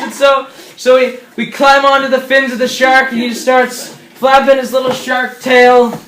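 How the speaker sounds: ordinary delivery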